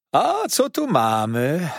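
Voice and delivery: slow, loud, high exclamation; calling out. A narrator's reading. Waiter reaching for the dish, calling out delightedly. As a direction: cry out with drawn-out delight. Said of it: "zawołał".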